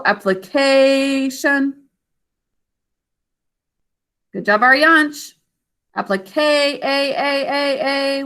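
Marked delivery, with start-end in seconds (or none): 0.0-1.9 "Application" with a long held KAY, weak ending; stretched syllable demo. Shown as a demonstration. Teacher showing the unstressed ending, long KAY in "Application". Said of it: "How do we mark this syllable?". 6.0-8.2 "Applique" running on into repeated long "A"; sustained vowel sound. Shown as a demonstration. Teacher asking what you hear, echoing the long "A" on one pitch. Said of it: "What do you hear here?".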